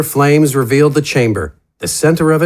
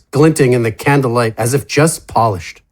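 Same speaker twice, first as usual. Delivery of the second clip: plain reading voice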